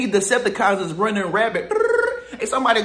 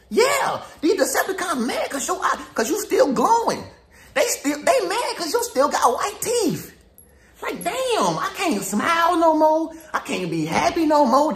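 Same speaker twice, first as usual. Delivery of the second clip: higher, laughing mock whine of a jealous rival; mock hater; 6 semitones higher than usual. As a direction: whine like a sore loser, pitched up. Mocking haters' voice.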